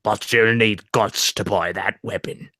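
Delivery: low, slow put-on Cockney; Cockney merchant. Co-host finishes requested merchant line, low Cockney. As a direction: sink deep and slow into mock Cockney. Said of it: "with a Cockney accent".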